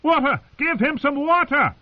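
calling out. Acted. A guest calls out for water for the king. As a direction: shout across the hall for water. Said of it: "A different voice was calling".

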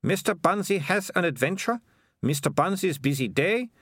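high character voice, disdainful; Melissa, scornful girl. A narrator's reading. Melissa to Keith about a children's book, scornful.

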